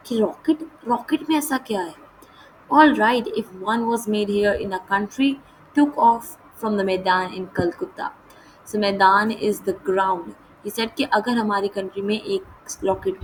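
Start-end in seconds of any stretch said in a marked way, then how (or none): 2.7-8.1 measured, slow read-aloud of the English text; deliberate storybook narration; slow story reading. A narrator's reading. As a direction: read each phrase out slowly and clearly, like a lesson passage.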